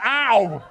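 loud mock yelp of pain; hammed-up ow. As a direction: yelp as the incense burns. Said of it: "I'm like".

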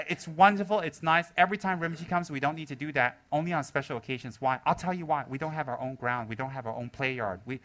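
ordinary talk, nothing marked

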